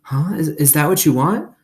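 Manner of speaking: put-on young girl voice. A narrator's reading. Opal coaxing the scared dog.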